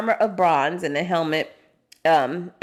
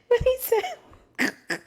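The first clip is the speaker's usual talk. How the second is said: laughing; much slower than usual. Real, not acted. Tickled reading the verse.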